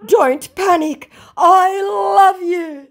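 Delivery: very high, loud, drawn-out declaration; hammed-up lovesick wail; mock adoration. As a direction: gush slowly in a squeaky put-on voice. Voices scammer's love message.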